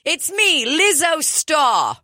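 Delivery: high, stretched mock British; British accent. Co-host capping the English singer riff, British accent. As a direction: draw the name out in fake British. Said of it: "you were doing a British accent".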